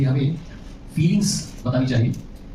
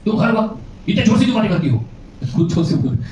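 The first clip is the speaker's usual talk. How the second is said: quarrel accusation; 7 semitones higher than usual. Retold or imitated. Husband acts out a quarrel, accusing his wife in a raised voice. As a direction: blame her like in a fight.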